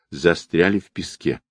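usual speaking voice

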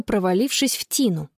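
usual speaking voice

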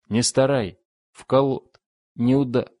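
urgent shouted scraps of words; shouting in fragments. Acted. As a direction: call out in broken pieces, each cut short. Broken shouts through rushing wind.

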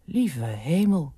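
slow, stunned exclamation; slow disbelief. A narrator's reading. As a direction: draw it out in dismay. Stunned that Frits is gone.

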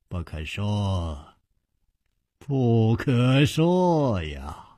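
very slow, drawn-out words; teasingly mysterious. A narrator's reading. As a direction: stretch each syllable like a sage keeping a secret. 楚留香故意卖关子. (故弄玄虚，拖长声调)

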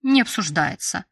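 ordinary delivery

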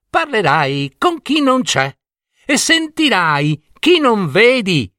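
high riddling read; reading the riddle. A narrator's reading. Fox doorkeeper reading the riddle to Jelina, mysterious.

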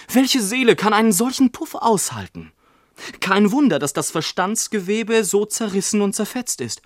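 hoch und etwas lauter, aufgebracht; verzweifelter Ausruf. A narrator's reading. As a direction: klagt laut über das zerquetschte Gehirn. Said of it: "schrie mein Vater". (hoher, aufgebrachter Klageruf)

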